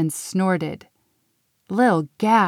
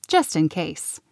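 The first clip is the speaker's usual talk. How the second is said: plain reading voice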